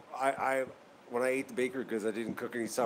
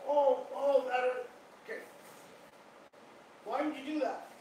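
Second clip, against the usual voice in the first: pet-talk voice; 8 semitones higher than usual. Real, not acted. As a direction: coax the little duck gently, higher than usual. Coaxing injured pet duck.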